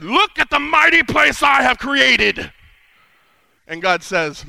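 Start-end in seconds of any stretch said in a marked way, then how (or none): 0.0-2.5 slow, loud, grand proclamation; king bragging over Babylon; boastful king. Acted. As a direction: puff up like a proud ruler. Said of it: "Nebuchadnezzar said".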